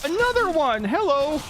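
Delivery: high, incredulous outcry; alarmed surprise. Real, not acted. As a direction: cry out in dismay as another shows up. Yet another enemy appears in game.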